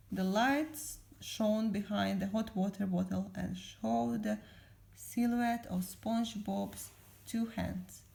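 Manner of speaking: ordinary delivery, nothing marked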